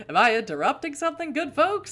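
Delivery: loud, high, hammed-up character voice; coked-out Gary Busey. Carter interrupting the good folks, coked-out and loud. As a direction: burst in booming and manic. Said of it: "That's the voice I have to give him because he looks like a coked out Gary Busey".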